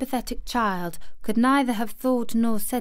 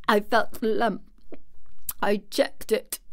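halting, choking words; choked-up, gasping child. A narrator's reading. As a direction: force the words through a tight, sobbing throat. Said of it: "choked out Colin".

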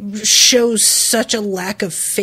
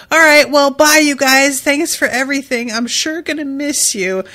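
Luffy's goodbye; 5 semitones higher, 4 dB louder than usual. Retold or imitated. Luffy's farewell to bandits.

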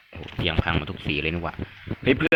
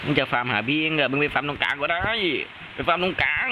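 raised pitch, climbing with each demand; exasperated ordering; 6 semitones higher than usual. Real, not acted. Gamer telling teammate to farm in the middle, exasperated. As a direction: tell them off, pushing higher each time. Scolds teammate over farming spot.

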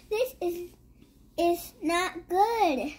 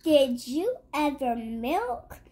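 ordinary delivery

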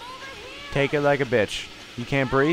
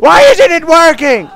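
very loud, high yell; frustrated scream; 17 dB louder than usual. Real, not acted. Player whose fire extinguisher fails, frustrated scream. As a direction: scream at the broken thing.

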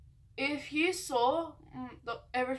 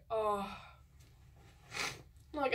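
ordinary talk, nothing marked

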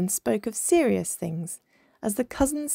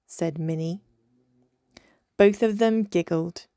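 ordinary delivery, nothing marked

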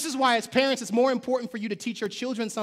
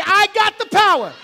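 high, loud shout; screamed; 12 semitones higher, 12 dB louder than usual. Shown as a demonstration. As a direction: yell it to bursting. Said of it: "screamed it loud enough that you would explode".

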